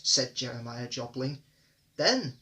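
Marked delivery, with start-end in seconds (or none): 1.9-2.4 a firm, stern then; the guard turns stern. A narrator's reading. Guard to a stubborn passenger, stern and firm. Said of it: "said the guard sternly".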